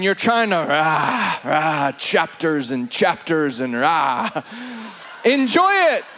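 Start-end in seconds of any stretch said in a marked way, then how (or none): 0.6-4.3 mock drudgery. Mocking dutiful Bible reading. 5.2-6.0 a rising rush of excitement; excited proclamation. Real, not acted.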